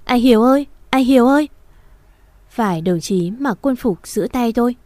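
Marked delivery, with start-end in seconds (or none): 0.0-0.7 louder, desperate cry of his name; frantic wailing call. A narrator's reading. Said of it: "như phát điên gào thét". 0.9-1.5 louder, desperate cry, pitch climbing higher; the second cry breaks higher, out of control; frantic wailing call. A narrator's reading. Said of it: "như phát điên gào thét".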